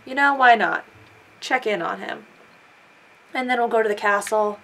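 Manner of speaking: ordinary talk, nothing marked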